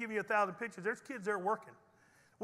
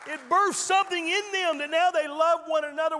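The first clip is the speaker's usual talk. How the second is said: raised, loud preaching voice; 7 semitones higher, 7 dB louder than usual. Real, not acted. Pastor preaching hard to his church. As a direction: drive it home to the congregation.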